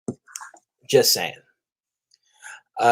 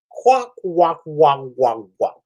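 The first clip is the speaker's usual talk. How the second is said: clucking quack walk mockery; chicken impression; slower than usual. Mocking Namor as a clucking chicken. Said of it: "he sounds like a chicken".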